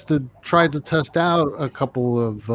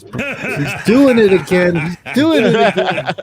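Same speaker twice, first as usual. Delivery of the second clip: higher and louder through laughter; laughing; 4 semitones higher, 5 dB louder than usual. Real, not acted. Complaining about his friend while laughing, higher and louder.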